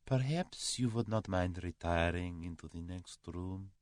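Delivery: soft, polite Russian suggestion. A narrator's reading. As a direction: make a hushed, courteous request. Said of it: "said the Russian softly".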